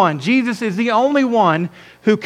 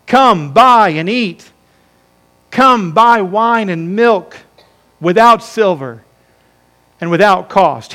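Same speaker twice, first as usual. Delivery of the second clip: slow, weighty phrases with long pauses; declaimed scripture reading. Real, not acted. Pastor reading Isaiah's invitation to the congregation, slow declaimed scripture. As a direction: proclaim it as a call to all.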